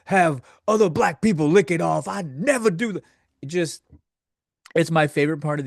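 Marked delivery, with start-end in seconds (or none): 0.0-3.0 mock tirade. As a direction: rant in outraged denial. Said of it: "go on a tirade".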